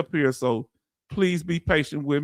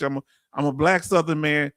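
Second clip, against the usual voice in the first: ordinary talk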